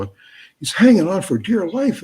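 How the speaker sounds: usual speaking voice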